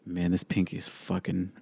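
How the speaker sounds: normal talking voice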